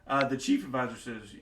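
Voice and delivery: ordinary talk, nothing marked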